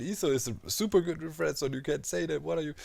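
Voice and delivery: high-pitched imitation of protesting fans; put-on outraged correction; mock indignant commenter. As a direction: mimic someone objecting in disbelief. Mimics indignant online commenters.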